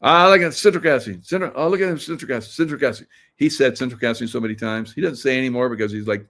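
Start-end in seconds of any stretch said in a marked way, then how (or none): 0.0-3.1 loud, high mocking singsong; hammed-up mockery of a repeated phrase; mocking catchphrase. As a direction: rub it in by repeating it.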